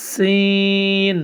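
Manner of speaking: সিন with a long hissing s, held out; long breathy Arabic sin; airy sin. Shown as a demonstration. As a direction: let the air stream through সিন. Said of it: "একটু বাতাসের মতো বের হবে".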